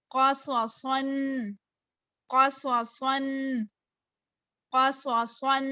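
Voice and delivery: usual speaking voice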